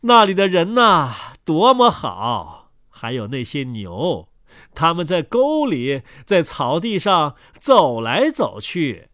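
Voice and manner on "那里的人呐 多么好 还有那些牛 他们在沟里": usual speaking voice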